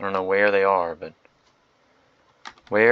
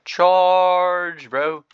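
mock battle cry; 9 semitones higher, 6 dB louder than usual. Cavalry charge cheered on.

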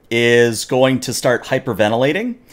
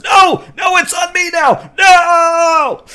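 very high, loud shrieking no; panicked Klingon scream; 5 dB louder, slower than usual. Acted. GM voicing the second Klingon as a tribble leaps on him, high shriek. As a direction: scream in high pitched terror. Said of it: "and he goes".

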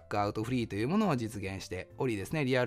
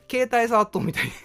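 laughing; 9 semitones higher, 7 dB louder than usual. Real, not acted. Laughing at own comparison.

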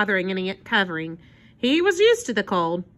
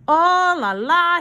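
loud, higher French exclamation; theatrical dismay, pitched up; exclaiming; 8 semitones higher, 6 dB louder, slower than usual. A narrator's reading. Armand reacting to the children's request, loud exclaiming. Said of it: "he exclaimed".

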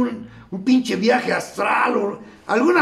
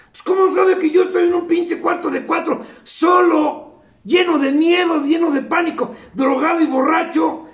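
alarmed shout; 6 semitones higher than usual. Retold or imitated. Sure the radio sees him, he shouts in alarm. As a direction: yell it like you've been caught.

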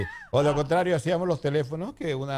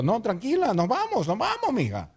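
mother's voice much higher, breezy; sweetly agree, to spoil the fun; mother happily joining her daughter; cheerful mother; 9 semitones higher than usual. Retold or imitated. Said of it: "Porque ella dijo".

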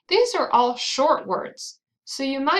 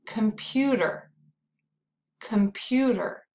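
"Computer" with the middle syllable stronger; stress on second syllable. Shown as a demonstration. Teacher introducing a three-syllable word, middle-syllable stress on "Computer". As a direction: lean on the "pu" in "Computer". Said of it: "it's the second syllable".

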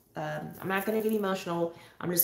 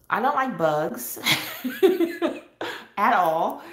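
laughing; 4 semitones higher, 5 dB louder than usual. Real, not acted. Laughing about fear of bugs.

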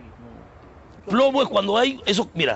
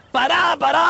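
bus-stop call; 4 semitones higher, slower than usual. Shown as a demonstration. Demonstrates calling the bus stop.

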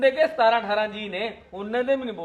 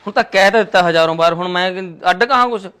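loud, fast exasperated yelling; fed-up shout; 13 dB louder than usual. Acted. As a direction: bellow it, sick of repeating. (ਤੰਗ ਆ ਕੇ ਤੇਜ਼ ਤੇ ਉੱਚੀ ਚੀਕਣਾ)

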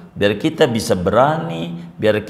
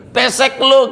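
a jeering taunt, voice pitched up; mocking taunt; 11 semitones higher, 4 dB louder than usual. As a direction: taunt him like a teasing stranger. (ejekan mengolok dengan nada tinggi)

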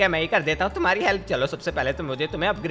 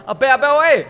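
loud, higher shout for attention; calling out; 6 semitones higher, 6 dB louder than usual. Acted. Pleading hero calling his friend for help, loud call. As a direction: yell to grab him.

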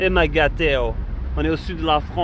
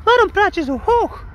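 very high pitch. Acted. Mocking the high voice back.

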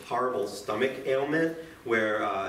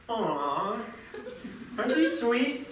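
high, half-laughing coo at cute chickens; mock-gush over something adorable; cooing over chicks; 8 semitones higher, slower than usual. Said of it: "And you can go".

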